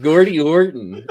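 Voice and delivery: put-on slow, drawn-out Canadian accent on Gordy Horton; a hammed-up Canadian accent. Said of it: "that's an awful rendition of a Canadian accent".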